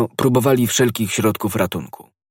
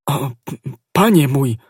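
higher, faltering stammer; 5 semitones higher, 3 dB louder than usual. A narrator's reading. Planchet to his master, stammering. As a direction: stumble over the words half-dead with fright. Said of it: "jąkał Planchet na wpół umarły".

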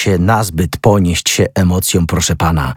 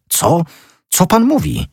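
very high, incredulous question; taken aback, voice jumping high; startled exclamation; 11 semitones higher than usual. A narrator's reading. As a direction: exclaim in disbelief, pitch shooting up. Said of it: "zawołał pan Fiel".

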